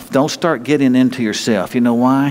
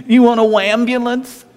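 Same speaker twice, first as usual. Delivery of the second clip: mocking taunt; 11 semitones higher than usual. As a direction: taunt the whiny kid. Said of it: "And he said".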